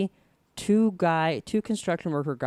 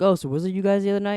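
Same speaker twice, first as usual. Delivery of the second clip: fast, high, louder accusing question; quick pitched-up mock interrogation; imitated questioning officer; 6 semitones higher, 5 dB louder, much faster than usual. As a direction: mimic a cop pressing kids. Police officer questioning the boys.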